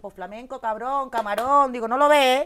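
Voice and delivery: high, loud and defiant; cheeky retort. Retold or imitated. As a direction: tell them off like they should know. Retorting to soldiers about music.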